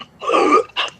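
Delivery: acted strangled gasp for air; mimed choking sound; choked gasp. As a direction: gasp as if your windpipe is pressed. Game master acts out man being choked.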